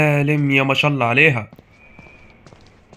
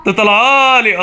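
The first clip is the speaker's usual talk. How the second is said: higher, louder alarmed cry; scared shout of alarm; frightened shout; 8 semitones higher, 6 dB louder, slower than usual. Real, not acted. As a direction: yell it as it jumps out at you. Monster jumps out at him.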